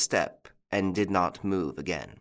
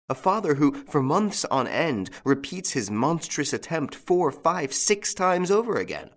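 high, rising indignation; indignant; 7 semitones higher than usual. Acted. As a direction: build outrage with each number. Indignant outburst about the father.

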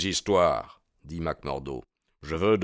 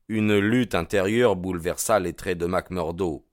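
usual speaking voice

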